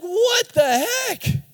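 high, slow, stretched-out disbelief; mock shocked parent. Parent watching baby jab a pen, high and drawn out. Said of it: "You're like".

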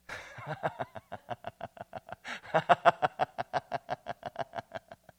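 breathy, rattling, sustained laughter; long laughing fit. Real, not acted. Pastor overcome with joy mid-sermon, long laughing fit. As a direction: laugh on and on in staccato bursts.

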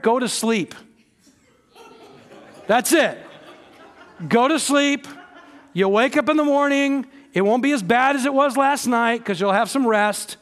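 emphatic preaching. Acted. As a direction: press the point home from the pulpit. Preacher urging, loud and high.

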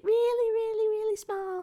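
high, squeaky little voice; tiny voice. Shown as a demonstration. Student describes tiny chat text, high tiny voice. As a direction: shrink the words into a high small voice.

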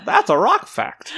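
high, lightly voiced little boy; rock fact voice. Excited friend imitating the little brother's rock fact. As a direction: chirp it like the kid with the rock.

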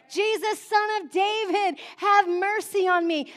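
high, loud plea for mercy; crying out. Acted. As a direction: call out as the blind man begging for mercy. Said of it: "when he heard the man say".

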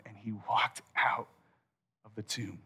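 whispered, sinking low and soft; hushed whisper. Real, not acted. Preacher hushing the congregation, whispering. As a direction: breathe it out in awe. Resurrection told in hushed awe.

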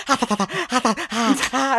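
scare a snake with goofy tongue sounds; mock snake-scaring tongue noises. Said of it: "just go like".

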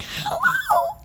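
very high, airy falsetto; drawn-out soft cartoon squeak; [name]. Shown as a demonstration. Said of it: "doing that voice a lot".

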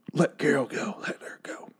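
soft pleading whisper; whispered plea. Retold or imitated. Fan begging the writers to free Carol, whispering. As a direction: beg the show under your breath.

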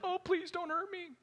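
high, soft, whimpering beast begging for mercy; pleading Leviathan. Retold or imitated. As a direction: beg like a cornered monster, small and pitiful. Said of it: "Imagine Leviathan pleading with God".

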